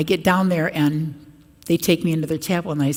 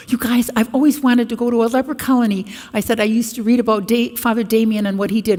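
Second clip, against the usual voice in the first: eager excitement; 6 semitones higher, 5 dB louder than usual. Retold or imitated. Her own eager retold plea.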